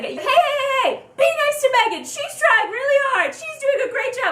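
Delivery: very high, protective mock pleading; Hannah. Idol steps out to defend the fan, high-voiced and protective. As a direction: scold sweetly in a squeaky high pitch. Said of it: "Hannah steps out and she's like".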